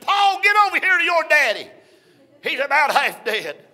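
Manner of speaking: high, loud urgent call; panicked call. Retold or imitated. Wife phones their son Paul, high urgent panic.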